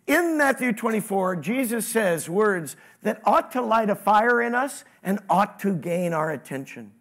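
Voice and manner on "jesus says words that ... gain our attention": ordinary talk, nothing marked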